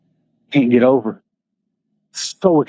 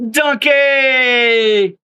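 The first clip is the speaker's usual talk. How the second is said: high drawn-out call of the name; greeting the donkey with a long high call; fond call; 9 semitones higher than usual. Real, not acted. Calling the donkey fondly.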